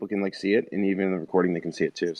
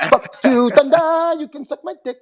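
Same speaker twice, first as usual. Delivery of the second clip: high loud yell; shouting Ted; 11 semitones higher, 5 dB louder than usual. Acted. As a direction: scream it at the sky. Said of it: "same voice".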